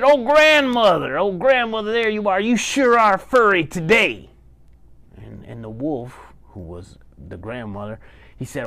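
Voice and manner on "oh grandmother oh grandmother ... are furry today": high, louder grandson exclaiming over grandma; surprised greeting. Acted. Grandson arriving to a furry grandmother, surprised and high. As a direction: greet grandma in raised, wondering tones.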